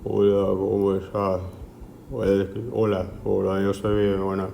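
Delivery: slow, low and dragging; slow, low hypothyroid voice. Shown as a demonstration. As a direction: drag the words slowly, low pitch. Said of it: "Suele escucharse como".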